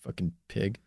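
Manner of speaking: low muttered insult. Real, not acted. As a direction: mutter it under your breath.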